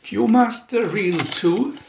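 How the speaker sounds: ordinary delivery, nothing marked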